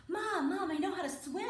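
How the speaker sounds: put-on high, eager child voice; excited little girl. Daughter telling mom about swimming, excited. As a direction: imitate a kid bursting to show off.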